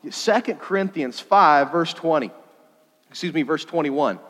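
usual speaking voice

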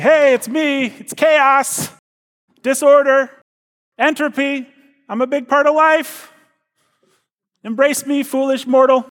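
high booming villain voice; a hammy dark lord calling mortals. Acted. Voices chaos knocking at door.

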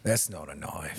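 low, slow mock-Australian drawl; hushed, deep imitation Australian accent. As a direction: parody an Australian film hero, softly and slowly. Quoting the Crocodile Dundee film line.

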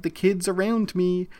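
ordinary delivery

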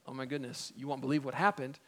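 hushed, low aside of disbelief; softly acted astonished texter; imagined text to a friend. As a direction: drop to a quiet, low "can you believe it". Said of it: "if you were texting this story".

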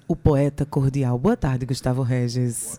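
low, slow announcer imitation; mock the host's deep voice; deep radio voice. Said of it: "eu queria que tu ouvisse assim".

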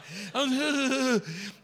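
high, put-on sob; mock crying. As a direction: let out a hammed-up wail. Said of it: "'cause I'm crying".

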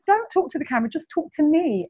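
lightly voiced kind cameraman; gentle reassurance. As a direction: coax her calmly, like a kind cameraman. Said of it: "And the guy said".

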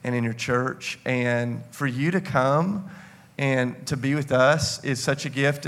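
ordinary talk

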